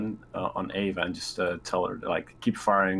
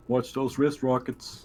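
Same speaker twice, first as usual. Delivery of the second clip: game quote; 4 semitones higher, slower than usual. Retold or imitated. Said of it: "I already said that one".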